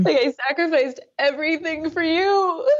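real sobbing accusation. Real, not acted. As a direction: cry it out to him. Client confronting dead father.

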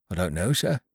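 plain reading voice

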